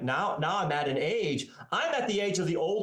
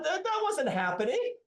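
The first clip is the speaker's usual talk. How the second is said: ordinary talk, nothing marked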